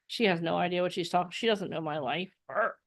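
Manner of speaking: low, soft, quick mock muttering; imitated dismissive mumble; sceptical grumble. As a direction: grumble it under your breath like a doubting listener.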